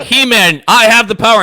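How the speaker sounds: loud, high heroic cartoon shout; He-Man. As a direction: proclaim it like a cartoon hero. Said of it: "It was like".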